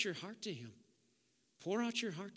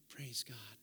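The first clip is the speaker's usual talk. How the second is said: whispered, hushed praise; 5 semitones lower, 9 dB quieter than usual. Real, not acted. Pastor praying quietly after his message, whispered. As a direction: breathe it out as a private prayer.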